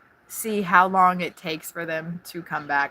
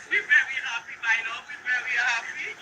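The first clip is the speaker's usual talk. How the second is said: soft high cooing; 5 semitones higher, 5 dB quieter than usual. Real, not acted. High soft talk to her love.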